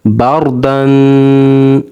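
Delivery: बार दन with a heavy, full रा, very slow. Shown as a demonstration. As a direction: draw out बार with a thick रा. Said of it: "यहाँ पर रा मोटा होगा". (बार दन में रा मोटा, धीरे-धीरे)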